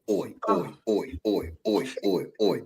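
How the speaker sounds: low mock Statham oi; Jason Statham grunts. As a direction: grunt it like a cockney tough guy. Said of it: "how Jason Statham sound".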